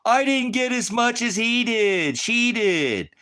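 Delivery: mock a whiny reality-show complainer; whiny contestant. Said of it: "one bitch in the fucking thing that's gonna fuck it up".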